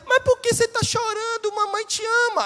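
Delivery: put-on high, sing-song mother's voice cooing at a baby. Mother cooing at her crying baby, high and sing-song. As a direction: coo it high like a doting mom.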